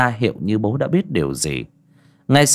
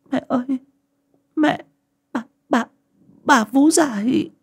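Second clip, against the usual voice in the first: put-on wailing child. A narrator's reading. Frightened little girl crying to her mother, high. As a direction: stammer through tears like a scared little girl. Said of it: "Tôi gào lên mếu máo".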